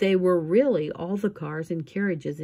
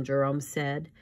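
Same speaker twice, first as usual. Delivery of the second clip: ordinary delivery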